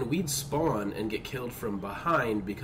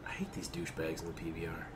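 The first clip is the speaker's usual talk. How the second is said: whispering; 6 semitones lower, 9 dB quieter than usual. Real, not acted. Grumbling about enemy boat.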